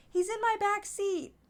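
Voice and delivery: pitched well up, retelling good news; high re-enacted announcement. Finder telling owner the dog is safe, high voice.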